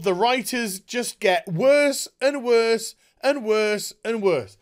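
ordinary delivery

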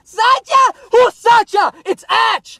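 very high squealed shouting; hammed-up indignant falsetto; mock outrage. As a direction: shriek in comic disbelief. Mock outrage at French name.

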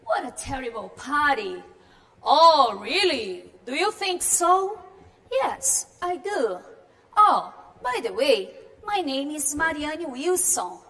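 slow, lightly acted party guest; Marianne reading. Teacher voicing Marianne at the party, slow and lightly acted. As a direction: read her lines slowly, like a polite guest.

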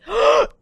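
loud, high gasp of surprise; sudden excited gasp; sharp gasp. Real, not acted. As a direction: suck in a startled breath. Excited reaction during game.

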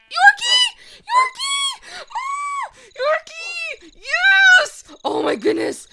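shrieking the dog's name high and loud; excited shriek. Real, not acted. Gamer spotting a Yorkie, squealing with delight. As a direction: squeal with joy at the found puppy. Finding a lost puppy.

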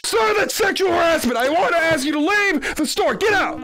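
angry shouting. Acted. Barista orders him out.

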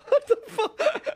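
high, loud laughing disbelief; real burst of laughter; laughing hard. Real, not acted. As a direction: crack up, then blurt it out.

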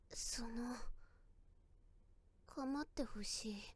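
very soft and slow; shy quiet confession. Acted. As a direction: murmur it bashfully. Shy confession after complaint.

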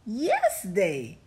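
plain reading voice

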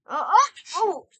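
high, loud play-fight cries; hammed-up hurt noises; mock pained grunts. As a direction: yelp as if hit in a pretend fight. Pretend fight in toy play.

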